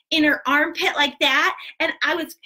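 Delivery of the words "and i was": ordinary delivery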